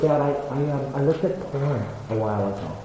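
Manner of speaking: soft, halting admission, voicing a son; retold hesitant confession. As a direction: quietly imitate a reluctant confession to dad. Son's reluctant confession retold.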